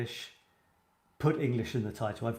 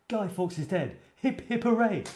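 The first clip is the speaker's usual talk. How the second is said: cheering finale; 8 semitones higher than usual. Shown as a demonstration. Teacher ends the Bonfire Night rhyme, excited cheering.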